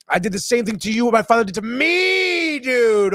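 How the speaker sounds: mock-anguished cry; acted outburst. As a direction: voice a dismayed realization, pitch climbing high. Voices Sophia's anguished realization.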